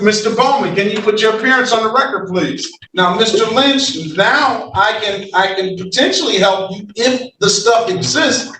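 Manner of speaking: normal talking voice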